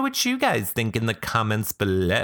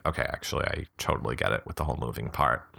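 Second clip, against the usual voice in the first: quiet aside; 8 semitones lower, 5 dB quieter, faster than usual. Real, not acted. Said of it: "quiet aside".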